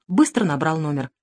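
plain reading voice

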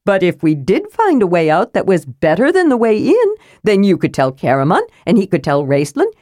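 cheerfully matter-of-fact; Tass the kender, chipper. Acted. Tass eager plan.

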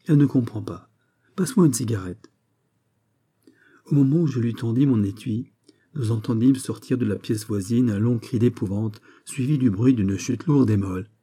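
usual speaking voice

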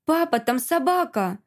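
put-on high-pitched child's cry of alert; exclaiming. A narrator's reading. Boy tells father about the dog, exclaiming. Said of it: "воскликнул до этого притихший Игнат".

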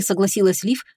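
ordinary delivery

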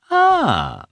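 high, loud mock-surprised exclamation; feigned surprise. Betsy greets Karenin with staged surprise. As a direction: put on a show of being startled. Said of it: "she exclaimed, as if in surprise".

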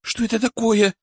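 put-on high, tearful girl's voice; nearly crying. A narrator's reading. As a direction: ask in fright, on the verge of tears. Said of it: "чуть ли не плача спросила Даша".

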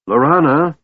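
high, loud call of a name; shrill shout. A narrator's reading. Tass calling Laurana across the morning air, shouting. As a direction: shout it high, like an off-key trumpet. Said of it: "shouted Tass's shrill voice".

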